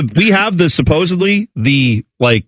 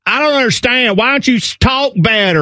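high, far-off sneering put-on voice; mocking a rude complainer; 9 semitones higher than usual. Mocking someone who won't understand an accented speaker, in a high sneering put-on voice. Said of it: "someone is speaking with an accent and they're like".

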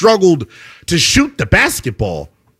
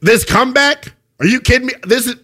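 ordinary delivery

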